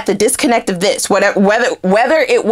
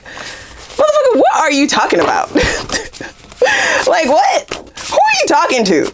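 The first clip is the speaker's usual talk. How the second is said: incredulous outburst; 8 semitones higher than usual. Retold or imitated. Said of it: "You like".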